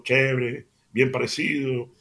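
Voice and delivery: ordinary talk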